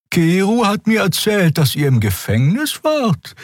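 high, bright creature voice; a small winged creature prying gently; curious Xea. A narrator's reading.

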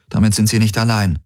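plain reading voice

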